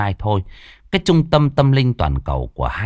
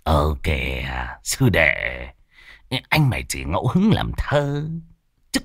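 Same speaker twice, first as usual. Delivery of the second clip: placating; 6 semitones lower, 4 dB quieter than usual. Acted. Soothing angry friend.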